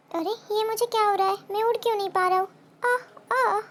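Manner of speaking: panicked weak chick. Acted. Exhausted chick can't fly.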